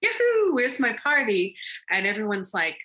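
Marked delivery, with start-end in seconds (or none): 0.0-1.5 high loud yahoo, bouncing in; excited whoop. Retold or imitated. Walking into the room expecting a party, excited whoop. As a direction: cheer as you burst through the door. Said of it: "I was so excited".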